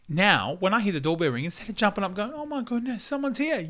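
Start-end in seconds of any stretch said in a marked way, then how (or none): 2.3-3.6 mock excited squeal. Excited about the doorbell.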